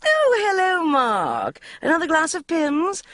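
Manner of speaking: high, sugary greeting; gushing hostess. A narrator's reading. Una greeting Mark sweetly, high and gushing. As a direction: turn on the charm for Mark.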